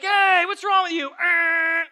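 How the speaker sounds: high, loud hammed-up yell; mock road rage. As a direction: act out an indignant driver yelling, ending in a growl. Acted anger at being cut off.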